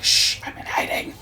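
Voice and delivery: whispered hush, imitating a hiding man; whispered aside. Mad Goro shushing Atsu on the mountain, whispering. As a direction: breathe it like a secret. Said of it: "He's just like".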